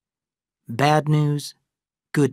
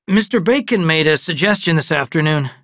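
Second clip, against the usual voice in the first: a lightly voiced father, a bit raised; father gently breaking news, raised; [name]; 5 semitones higher, 7 dB louder than usual. Father telling his twin sons about moving, lightly voiced.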